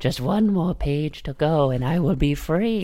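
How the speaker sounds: lightly voiced scheming villain, slow. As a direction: draw it out like a trapped schemer. Mock trapped villain.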